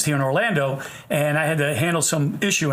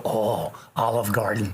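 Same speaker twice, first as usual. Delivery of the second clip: lower, slow and deadpan, like a clueless local; mock local voice; 9 semitones lower, 4 dB quieter, slower than usual. Dentist mocking Florida locals' restaurant advice, low slow deadpan. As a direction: drop low and drawl it like it's obvious.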